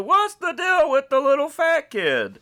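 high, loud Seinfeld observational whine. Retold or imitated. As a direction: ask it like a stand-up bit. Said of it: "I hate to wax Seinfeld on you".

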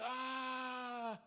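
long, high put-on wail; mock crying wail. Preacher mimicking false repentance, long high mock wail. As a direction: fake a drawn-out sob. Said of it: "thinking that if you have to cry".

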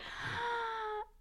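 mock shocked gasp. Mock panic at being stared at.

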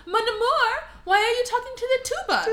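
high, loud, hurried mock wail; upset Fifi. Fifi questioning her lover about the tuba, high mock wail. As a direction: plead shrilly as a hammed-up jealous lover. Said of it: "So she's like".

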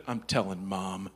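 put-on low, slow, ominous kid threat; a touch of a sulky daughter threatening; low mock threat. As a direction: deliver it like a doom verdict. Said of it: "she struck fear into my heart".

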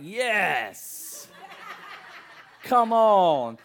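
high, loud, eager cheer; relieved cheer. Retold or imitated. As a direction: cheer her arrival home. Relief when wife arrives.

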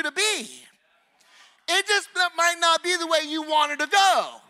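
high, forceful sermon build, question upon question; impassioned preaching. Real, not acted. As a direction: preach it with mounting urgency.

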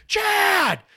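loud high yell, hard on the name; mom yelling a name. Retold or imitated. Mom calling her son back from traffic, loud yell. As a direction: shout the name with passion and emphasis. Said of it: "She's gonna stand on your name".

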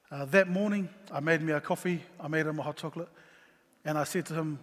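ordinary talk, nothing marked

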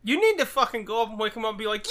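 usual speaking voice

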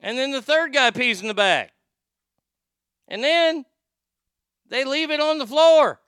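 high, loud, building disbelief; outraged retelling. Real, not acted. Host reaches the gross climax of the story, loud outrage.

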